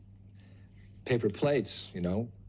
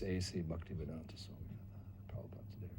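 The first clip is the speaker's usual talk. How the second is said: whispered, awed reading; reverent whisper; 4 semitones lower, 13 dB quieter than usual. Retold or imitated. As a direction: hush it as if before something sacred. Reading the guru's door sign.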